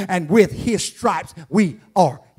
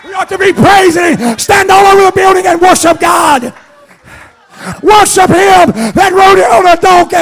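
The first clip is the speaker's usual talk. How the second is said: much higher, much louder whooping preacher, slow held vowels; preaching at full force; 12 semitones higher, 9 dB louder, slower than usual. Acted. Preacher whooping Hosanna to the church, much higher and louder. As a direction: shout it to the high top.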